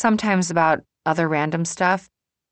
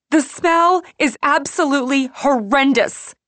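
loud, high-pitched outraged guest; yelling complaint; 10 semitones higher, 6 dB louder than usual. A narrator's reading. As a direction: yell your disgust at the hotel staff. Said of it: "Some woman is yelling at Patsy".

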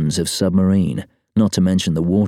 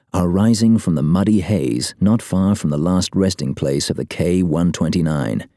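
ordinary delivery

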